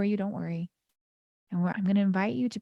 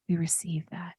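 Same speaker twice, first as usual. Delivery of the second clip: ordinary talk, nothing marked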